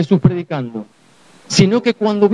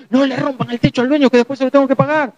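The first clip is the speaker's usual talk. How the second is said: higher, worried plea; fretful paralytic; 8 semitones higher than usual. Retold or imitated. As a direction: fret about paying for the roof. Poor atrophied paralytic pleading.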